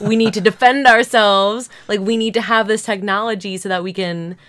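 loud, urgent blockbuster president speech; rally the nation like an action film; dramatic movie declaration. Retold or imitated. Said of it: "like Independence Day".

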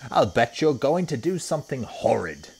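loud, high disapproving hippo; Humpy Rumpy. A narrator's reading. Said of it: "said Humpy Rumpy".

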